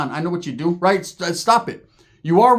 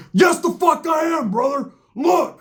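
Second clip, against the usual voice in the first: high, drawn-out outraged protest; imitated indignant shout; 11 semitones higher, slower than usual. Roach indignantly insists he is racist.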